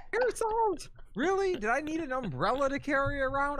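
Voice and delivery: high incredulous complaint. Real, not acted. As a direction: squeak out the disbelief. Mock outrage about parasols.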